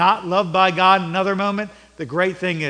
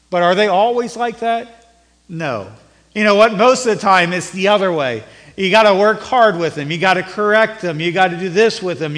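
raised, louder preaching voice; fervent sermon delivery; 3 semitones higher, 7 dB louder than usual. Acted. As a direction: preach it out to the congregation. Preaching on parenting.

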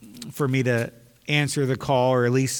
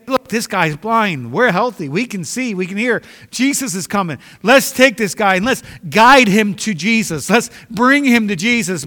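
caring crowd; 6 semitones higher, 7 dB louder than usual. Acted. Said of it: "they should have said".

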